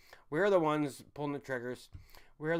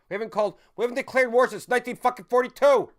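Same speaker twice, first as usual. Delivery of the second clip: much higher and louder, exploding on the year; furious shout; 10 semitones higher, 12 dB louder than usual. Real, not acted. Shouting to the stream that war wasn't declared since 1942, furious. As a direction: blow up in disbelief. Outburst on learning the war fact.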